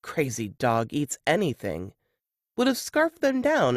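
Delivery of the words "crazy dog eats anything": ordinary delivery, nothing marked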